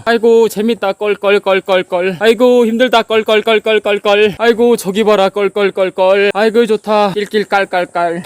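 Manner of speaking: loud fake guffaws, spelled out; high, mock-cheerful exclamations with chanted ha-has; forced laughing. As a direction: force hearty laughter through the struggle. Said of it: "완전히 웃으면서 해야 돼요".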